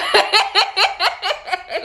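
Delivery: high, loud burst of laughter. Real, not acted. Cracks up while hyping up Amber's reading. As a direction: cracks up laughing at her own words. Breaks off mid-sentence laughing.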